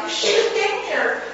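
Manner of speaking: high, grieving cry at his feet; high grief-stricken lament. A narrator's reading. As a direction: let the grief spill over as you plead. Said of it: "crying out".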